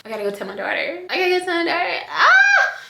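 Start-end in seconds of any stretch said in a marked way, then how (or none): 1.1-2.8 tearful, laughing shout; real overjoyed yell, much higher; joyful shout. Real, not acted. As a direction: cry out with happy tears. Rushing to tell daughter.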